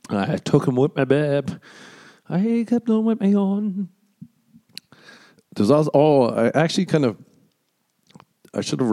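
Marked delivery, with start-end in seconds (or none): 0.1-3.9 lightly voiced lover. Voicing lover from story.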